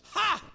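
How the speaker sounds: high shouted mocking "Ha!"; scornful laugh. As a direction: shout one derisive laugh. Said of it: "laughs at these pretensions".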